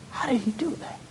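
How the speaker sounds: whisper. Retold or imitated. Wondering aloud in awe.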